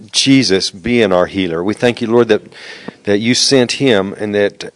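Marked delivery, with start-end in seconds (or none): none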